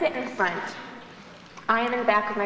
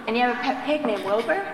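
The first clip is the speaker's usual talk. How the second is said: ordinary delivery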